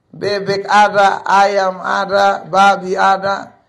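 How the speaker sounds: each item drawn out slowly; slow listing. Acted. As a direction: count them off one by one slowly. Listing animals once owned. (menyebut satu per satu dengan lambat)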